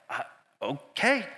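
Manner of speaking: slow, drawn-out, unsure okay; reluctantly agree, stretching it out; hesitant agreement. Retold or imitated. Intern hesitantly agrees to meet.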